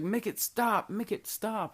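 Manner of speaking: high, pleading make it stop; panicked plea. Retold or imitated. Friend begging his buddies in panic, high pleading voice. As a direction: beg like you're losing it, voice pitched high.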